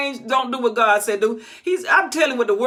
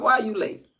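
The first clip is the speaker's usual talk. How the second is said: usual speaking voice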